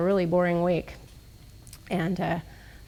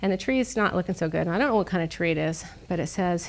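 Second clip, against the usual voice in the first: ordinary delivery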